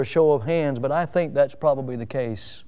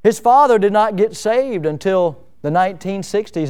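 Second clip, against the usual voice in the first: usual speaking voice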